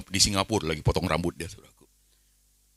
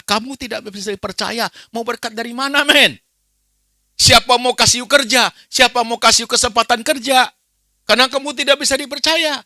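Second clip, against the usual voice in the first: much higher, much louder fiery sermon shouting; full-force preaching; 10 dB louder than usual. Acted. Preacher rebuking uncaring families, full-force shouting. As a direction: thunder it at the congregation.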